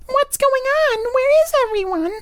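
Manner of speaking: very high, put-on anime girl voice; mock a phony cutesy heroine, way up high; Stephanie Sheh moe voice. Mocking a dub actress's anime roles in a very high phony voice. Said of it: "this fucking phony, put on".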